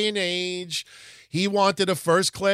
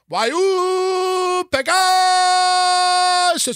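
much higher, louder, very slow broken-English question; mock Japanese accent; 7 dB louder, much slower than usual. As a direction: drag it out in a hammed-up Japanese accent. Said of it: "when I do impersonations of Japanese people".

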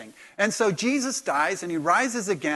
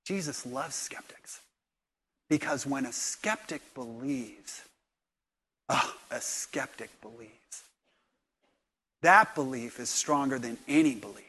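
tender; 4 semitones lower, 5 dB quieter than usual. Real, not acted. Pastor's tender point.

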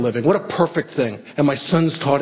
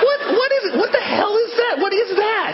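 much higher, laughing what is that; startled surprise. Real, not acted. Comic asks about a noise, high, laughing and baffled. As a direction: break off, baffled and amused by a noise. Noise interrupts the show again.

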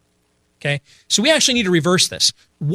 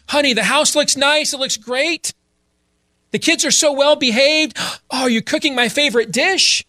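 high, gushing sweet talk; exaggeratedly nice husband; 8 semitones higher than usual. Husband home from work flattering his wife, hammed-up nice. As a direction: lay the compliments on thick in a high voice. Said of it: "He said it nice, right?".

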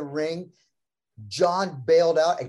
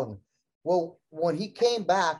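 normal talking voice

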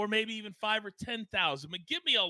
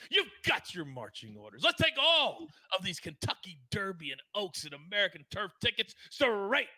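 ordinary delivery

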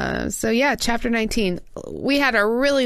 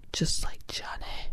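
whisper; 9 dB quieter than usual. Acted. Sultry joke about romance hero.

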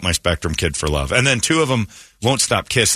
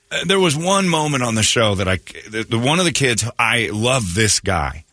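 ordinary delivery

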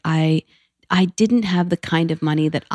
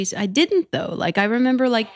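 usual speaking voice

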